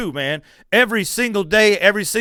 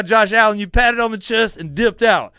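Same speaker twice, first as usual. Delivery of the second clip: ordinary talk, nothing marked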